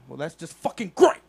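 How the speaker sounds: furious mock cop yell, high and loud; flipping out. Retelling a cop losing it, yelling high and loud. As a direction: rage at him like a cop losing it. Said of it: "And he just flipped out".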